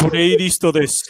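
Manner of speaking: Japanese accent. Shown as a demonstration. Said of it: "japonês falando inglês".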